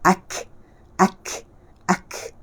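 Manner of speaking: "ak" held shut, then a brief airy k; syiddah kaf. Shown as a demonstration. Teacher shows syiddah, stopped "ak" with short puff. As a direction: stop "ak" firmly before releasing the air. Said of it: "ya, syiddah".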